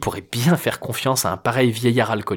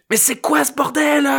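yelling. A narrator's reading. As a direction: scream it over the music. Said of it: "puis hurla".

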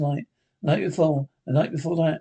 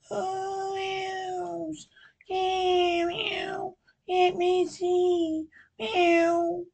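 high, whispery cat-woman mews; slow, sly, high mewing; 4 dB quieter, much slower than usual. Acted. Cat-woman teasing the officer from the bedroom, high mewing.

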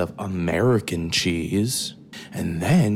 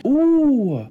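high, loud, drawn-out ooh; hammed-up coo of discovery; exaggerated delight; 6 dB louder than usual. Mock delight on finding food.

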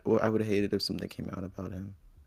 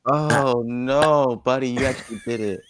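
excited shouting; 3 semitones higher, 9 dB louder than usual. Real, not acted. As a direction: cheer it out loud. Reacting to the close-mic burp.

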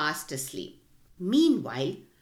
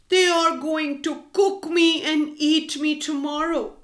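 a raised, mournful cockerel voice; sadly; 6 semitones higher, 5 dB louder than usual. A narrator's reading. Cockerel mourning his fate to the travellers, sadly. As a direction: sigh it out like a doomed bird. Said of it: "he said sadly".

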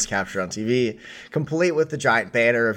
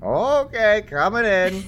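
announcing entry; 12 semitones higher than usual. Acted.

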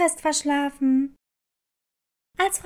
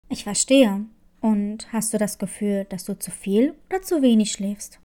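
lower, calm nurse asking gently; school nurse voice; 8 semitones lower than usual. Acted. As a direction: drop low and ask like a kind school nurse. Nurse questions Clara.

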